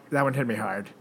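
soft, sorrowful repeat; quietly sad. Real, not acted.

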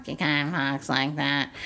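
low comic impression of a comedian; quick low caricature; Roseanne Barr. Shown as a demonstration. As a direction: drop low into a celebrity impression. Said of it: "I eventually did Roseanne Barr".

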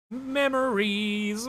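slow, soft and nostalgic; wistful. Real, not acted. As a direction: sigh it out, wistful. Nostalgic sigh.

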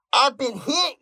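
loud, high wounded yell. Acted. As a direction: shout it through the pain into the mic. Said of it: "yelled the wounded trucker".

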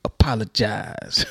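slow, low drawn-out apologize. Retold or imitated. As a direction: drag it out slowly like the cartoon.

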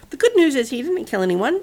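ordinary delivery, nothing marked

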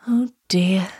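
sighed, mildly forlorn; sighing. A narrator's reading. Lonely girl longing to hear the news, sighing. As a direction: let it out as a sigh. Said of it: "she sighed".

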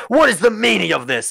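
high, loud outraged ruler; booming Duke. Acted. Game master as new Duke standing up, booming outrage. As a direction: boom it out like an affronted duke. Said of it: "the booming voice of the new Duke".

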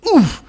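loud high grunt; oof of falling. A narrator's reading. Ryan tripping on a foot, loud grunt. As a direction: grunt as you hit the ground. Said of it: "he went".

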